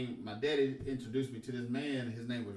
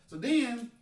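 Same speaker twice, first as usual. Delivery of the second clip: usual speaking voice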